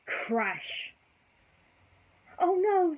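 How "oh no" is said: high, loud alarmed cry. Acted. Lady hears the crash in the dining room, high loud alarmed cry. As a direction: gasp it out as the dishes crash. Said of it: "Lady cried".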